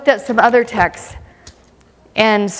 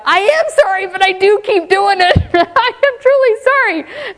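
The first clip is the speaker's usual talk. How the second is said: pleading to God; 10 semitones higher than usual. Retold or imitated. Preacher retells his plea to God, high and laughing. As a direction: plead your case with a guilty laugh.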